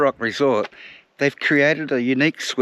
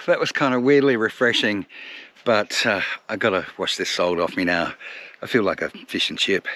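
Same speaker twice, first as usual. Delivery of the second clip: ordinary delivery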